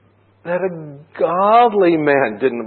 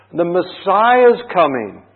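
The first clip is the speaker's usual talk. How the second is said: excited proclaiming; 7 semitones higher, 5 dB louder than usual. Real, not acted. Preacher proclaims good news.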